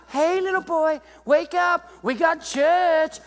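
high, sing-song wake-up call; lightly voiced parent rousing a boy; waking a boy. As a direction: coax a sleepy kid awake. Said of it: "You gotta wake them up".

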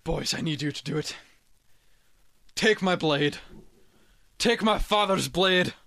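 put-on generic Scottish accent building to a high, loud, slow last command; a hammed-up Scottish accent swelling into a rallying cry; dying Scottish warrior. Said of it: "it's my generic Scottish voice".

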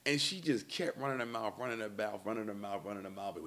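normal talking voice

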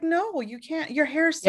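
high, cooing admiration; mock gushing stranger. A stranger fawning over her hair, cooing high. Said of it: "It's like".

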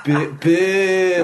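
high, loud mock-Scottish boo, half laughing; Scottish accent. Comic imitating how Scots would go boo, loud Scottish accent. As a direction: push out a big Scottish boo. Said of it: "And, like, Scots would go".

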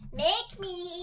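high, put-on bratty defiance; teenager voice. Reader mimicking Tommy's defiance, high teenage-brat voice. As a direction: mimic a defiant kid talking back. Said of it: "like, teenager voice to use".

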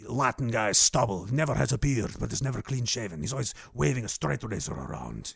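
low cartel-guy accent; put-on Latin accent, low and unhurried; Tony Montana. Shown as a demonstration. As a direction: stay in the gangster voice while describing him. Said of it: "That's the voice I was doing".

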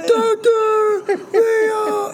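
very high, long-stretched falsetto holler; screaming a name. Retold or imitated. Co-host echoing Bob's scream for his doctor, falsetto holler. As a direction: imitate a far-carrying scream, each word dragged out. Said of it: "just starts screaming".

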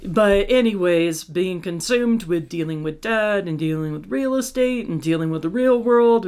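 usual speaking voice

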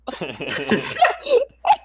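high shrieking laugh; loud burst of laughter. Real, not acted. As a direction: cracks up loudly.

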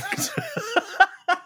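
squeaky high giggle; high squealing laugh. Real, not acted. High cackle after joke.

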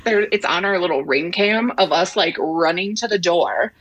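usual speaking voice

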